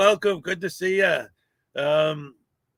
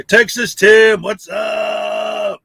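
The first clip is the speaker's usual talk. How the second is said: loud, slow, higher-pitched shout-out; calling out; 3 semitones higher, 9 dB louder, much slower than usual. Real, not acted. Streamer spots a missed viewer, loud drawn-out shout-out. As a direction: holler the name, then stretch the greeting.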